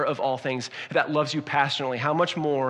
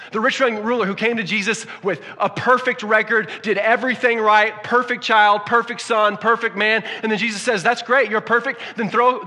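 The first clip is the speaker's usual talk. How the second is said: higher and louder, building urgency; full-force preaching; 7 semitones higher, 7 dB louder than usual. Acted. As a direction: preach it, rising to a peak.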